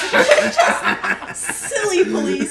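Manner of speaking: high, slow and loud through laughter; drawn-out mocking repeat. Real, not acted. Comic repeating her old bit about Canadian police, drawn out and high. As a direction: stretch it out for comic effect.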